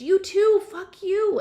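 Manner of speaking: shrill-high, very loud screech. A narrator's reading. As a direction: screech it at the top of the voice. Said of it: "Violet screeched".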